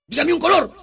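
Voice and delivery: loud, high demand; acted bullying demand. Ex-convict quizzing a frightened child, loud acted demand. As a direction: demand an answer from a pupil.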